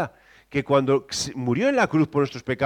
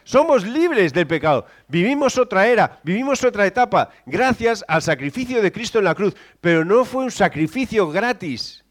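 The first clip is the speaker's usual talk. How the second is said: loud, high preaching; a loud, high fervent preach; 6 semitones higher, 6 dB louder than usual. Real, not acted. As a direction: preach it with full force. Preaching at full force.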